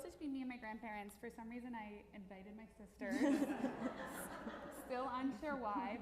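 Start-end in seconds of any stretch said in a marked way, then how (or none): 3.2-4.8 a loud burst of real laughter. Real, not acted. Granddaughter laughs while telling grandpa story, loud burst of laughter. Laughs at inviting her sisters. 4.8-6.0 laughing aside. Real, not acted. Giggling aside after laugh.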